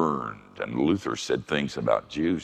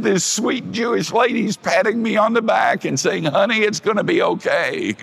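high, loud, breathless retelling; high excited letter writer; 7 dB louder than usual. Retold or imitated. Preacher reading the woman's letter in a high loud voice. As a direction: gush like an overwhelmed woman.

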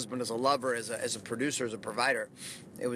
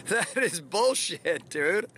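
drawn-out, higher-pitched quoted jab; scoffing brother-in-law; 4 semitones higher, much slower than usual. Retold or imitated. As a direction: stretch it out slowly in disbelief, imitating him. Quotes brother-in-law's scoffing comment.

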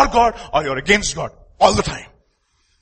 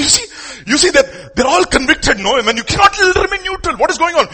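fiery preaching; 10 semitones higher, 6 dB louder than usual. Real, not acted. Preaching at full force.

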